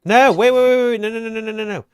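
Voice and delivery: high, loud frantic no, no, no; panicked shouting. Real, not acted. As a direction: shout to stop them in a panic. Panic as item gets scrapped.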